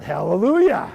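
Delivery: relieved, laughing cry of joy; joyful hallelujah. Real, not acted. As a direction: celebrate the good news with a laugh. Relieved joy at good news.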